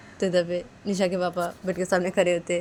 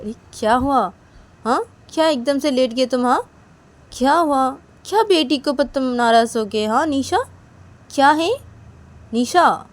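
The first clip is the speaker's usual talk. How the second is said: louder, higher, coaxing questions; concerned friend; 7 semitones higher, 10 dB louder than usual. Acted. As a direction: coax a sulking friend to talk. Manisha asks Nisha what's wrong.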